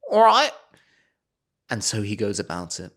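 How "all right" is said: mum voice. Retold or imitated. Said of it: "And she goes".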